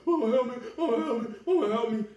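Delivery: high, loud mocking wail; mock crying for help. Preacher mimicking mockers once evil comes upon them, mock crying for help. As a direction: imitate desperate pleas in an exaggerated high voice. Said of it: "then they crying for help".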